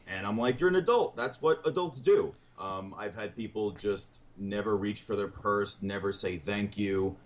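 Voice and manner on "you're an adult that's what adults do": exasperated, higher and louder retelling; exasperated retort. Retold or imitated. Comic telling a date that's what adults do, exasperated. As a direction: tell them off, pitched up and loud.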